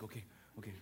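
okay breaking into laughter, low and soft; sheepish laughing okay. Retold or imitated. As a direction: laugh it off quietly, caught out. Husband retreating, laughing.

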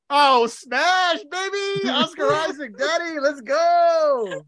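thrilled cheer. Real, not acted. Player thrilled to get Oscar Isaac, cheering. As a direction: cheer it out like a fan.

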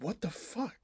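high-pitched baffled reaction; baffled disbelief. Retold or imitated. Retelling amazement at a British actor's Southern accent, baffled. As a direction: re-enact being stunned by what you hear.

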